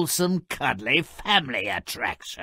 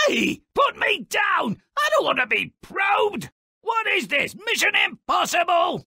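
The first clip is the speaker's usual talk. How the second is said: squeaky captive protest. Acted. Voices a captured guest protesting.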